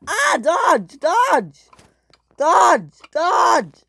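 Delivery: high, loud cries for help; panicked calling. Acted. Sydney stuck, calling to Dodge in panic. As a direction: yell the name high, each one falling.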